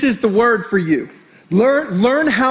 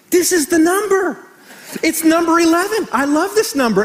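excited; 6 semitones higher than usual. Retold or imitated. Acted excited reaction to the number.

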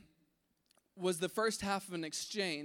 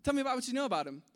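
excited; 6 semitones higher, faster than usual. Retold or imitated. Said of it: "he's probably excited".

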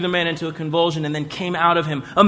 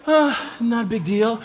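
mock nonchalance. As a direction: play it unbothered, as a joke. Mimics indifference to being chased.

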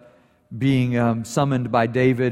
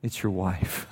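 much softer, lower, humble plea; gentle and soft; 5 semitones lower, 7 dB quieter than usual. Retold or imitated. Victoria softly asks her husband to let her in, tender. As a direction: speak tenderly, as a wife not a queen. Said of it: "she tapped gently on the door".

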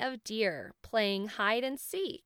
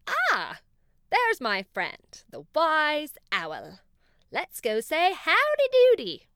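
loud, higher unicorn greeting, slow in the middle; delighted to spot an old friend; 6 semitones higher, 9 dB louder than usual. Acted. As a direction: unicorn spotting the owl, loud and drawn out. Unicorn spots the owl.

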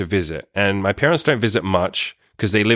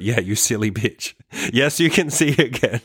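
laughing mock reply. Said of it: "I was like".

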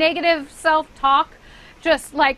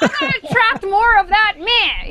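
laughing mock grumble; 7 semitones higher, 4 dB louder than usual. Jokes about attracting negative thoughts.

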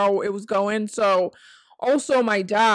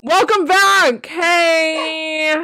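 bright show welcome; 6 semitones higher, 7 dB louder, much slower than usual. Acted. Show greeting.

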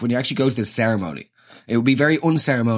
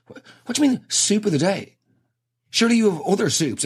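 indignant; 7 semitones higher than usual. Retold or imitated. Indignant customer quizzing the soup server.

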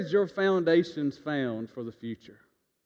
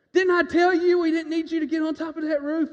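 scolding wife; 11 semitones higher, 3 dB louder than usual. Retold or imitated. Wife scolds husband on roof.

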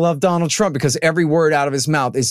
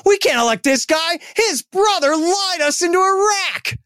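much higher, strained mock shout against Jeb; mock Trump attack. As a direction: hammer the brother line in a hoarse put-on yell. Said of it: "used it as the arrow in Jeb Bush's heart".

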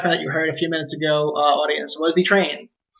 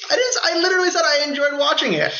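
laughing protest; 10 semitones higher than usual. Real, not acted. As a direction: laugh it out in disbelief. Exasperated protest while laughing.

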